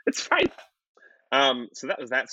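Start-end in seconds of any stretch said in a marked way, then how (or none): 0.1-0.5 very high, unconvincing reassurance; flustered. Retold or imitated. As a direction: squeak out that all is well, laughing between. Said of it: "you seem kind of, like, flustered".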